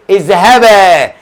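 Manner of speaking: very slow اذهبا with stretched ending; drawn-out word. Shown as a demonstration. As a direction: hold the final alef of اذهبا long. Stresses dual ending in verse word.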